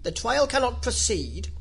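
loud, weighty royal pronouncement; very grave. A narrator's reading. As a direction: declare it gravely from the bench. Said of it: "in a very grave voice".

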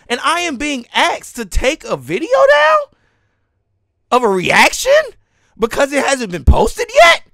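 high, indignant, incredulous outburst; real heated rant. Real, not acted. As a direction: rant in disbelief, pitch riding high. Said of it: "I am kinda mad right now".

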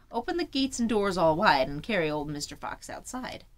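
usual speaking voice

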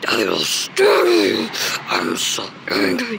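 distorted deep beast voice; monster voice. Acted. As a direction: growl it like a monster from deep below. Monster voice threatens.

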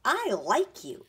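put-on high, loud, drawn-out child's voice; little boy. A narrator's reading. Small boy declaring his liking for visitor, high child voice. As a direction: play an open-hearted small boy, slowly.

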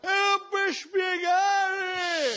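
high, very slow stretched voice; high drawn-out whine. Acted.

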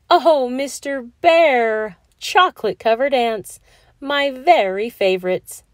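wife thrilled by her gift; delighted. A narrator's reading. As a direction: gush with joy. Delighted by chocolate ants.

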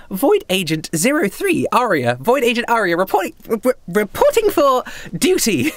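put-on high-pitched girlish self-introduction; overly optimistic agent. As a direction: report in proudly as a perky girl agent. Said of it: "And she goes".

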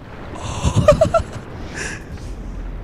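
long, high wheezing laugh; helpless laugh. Real, not acted. Laughing at the game.

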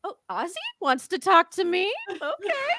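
very high, disbelieving fan voice; starstruck fan. Retold or imitated. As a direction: act out a flustered, thrilled fan. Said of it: "And I was like".

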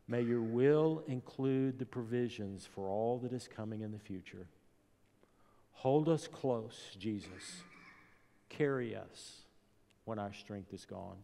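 quiet prayer. Acted. Preacher leads a quiet prayer.

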